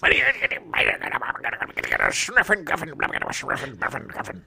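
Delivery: hammed-up sputtering gibberish rant; rasping nonsense grumble that dies away; snapping. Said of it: "suddenly snapping and just breaking things".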